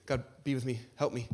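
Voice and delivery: mock a half-hearted routine prayer; generic prayer. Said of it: "we pray generic prayers".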